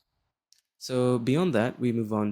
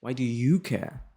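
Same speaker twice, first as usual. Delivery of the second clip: "you" accented and raised in pitch; slow question with "you" stretched and stressed; 2 semitones higher, slower than usual. Shown as a demonstration. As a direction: lean hard on the word "you". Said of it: "put emphasis on the you".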